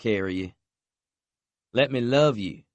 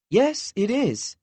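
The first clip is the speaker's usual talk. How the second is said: put-on insistent young woman; 14 semitones higher, 3 dB louder, slower than usual. A narrator's reading. Lexi insists.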